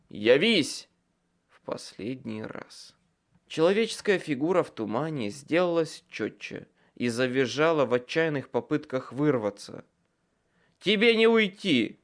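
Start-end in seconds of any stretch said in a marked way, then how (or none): 0.1-0.9 shouted command. A narrator's reading. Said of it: "я выкрикнул". 10.8-12.0 loud, much higher shout; shouted threat. A narrator's reading. Wizard yelling at a captured nightmare, triumphant shout. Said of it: "крикнул я".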